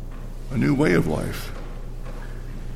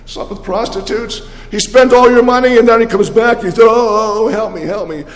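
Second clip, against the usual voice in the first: higher and louder, sneering complaint; scornful older brother; 7 semitones higher, 4 dB louder than usual. Acted. Older son mocking his returning brother to their father, louder and sneering. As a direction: throw the brother's sins back with scorn. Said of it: "the old brother points that out".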